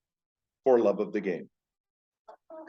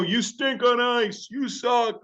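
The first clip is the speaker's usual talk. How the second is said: high, drawn-out heckler's jeer; imitated taunting from the stands; mock crowd yelling; 13 semitones higher, slower than usual. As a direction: jeer in a high, stretched voice like a fan. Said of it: "yelling at him".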